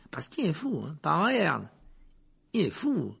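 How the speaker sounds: chatty conductor. A narrator's reading. Conductor recounting the killer's arrest to a passenger, excited. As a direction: gossip with relish about the crime. Stan tells the story.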